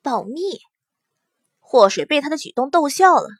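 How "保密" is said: softer, confiding word; hushed secret. A narrator's reading. Princess asks for secrecy, hushed. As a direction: keep it down, between friends. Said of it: "暗示祸水小声点儿".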